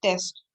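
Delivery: "टेस्ट" said without stretching the e; short vowel. Shown as a demonstration. Teacher showing not to drag the sound, "टेस्ट" kept short. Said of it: "लंबी खींच नहीं रहे हैं".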